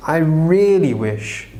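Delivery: slow, "really" drawn out and leaned on; stress on really. Shown as a demonstration. Teacher showing a big wish, stressing "really". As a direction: lean hard on "really" to show a big wish. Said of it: "I'm trying to stress this point".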